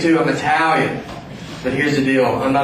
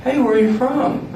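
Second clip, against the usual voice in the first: higher-pitched chirpy question; curious stranger; 10 semitones higher than usual. Retold or imitated. Strangers hear his accent and ask where he's from, curious.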